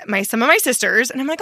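normal talking voice

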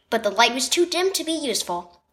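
ordinary delivery

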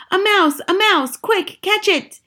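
high-pitched cries of alarm; urgent high calls to the others; shouting. A narrator's reading. Cooks set off after a mouse, shouting. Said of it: "they were shouting".

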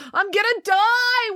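high-pitched, drawn-out panicked wail. A narrator's reading. Finn panics inside the dinosaur, wailing. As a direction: wail it high, stretching DIE. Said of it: "wailed Finn".

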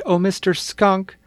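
high, loud call across the woods; calling loudly. Acted. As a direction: call out to the skunk from afar. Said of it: "May called loudly".

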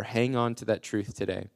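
ordinary talk, nothing marked

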